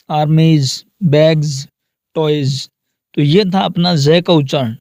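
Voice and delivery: normal talking voice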